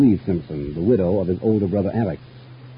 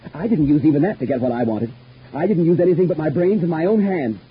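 high, rising agitated boast; unhinged boast; 8 semitones higher, 4 dB louder than usual. Acted. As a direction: build to a near-confession with fierce pride. Deranged boast of the killing.

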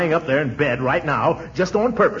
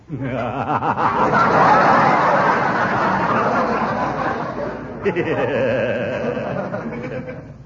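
long laugh; 4 semitones lower than usual. Acted. Laughing at McGee's grin.

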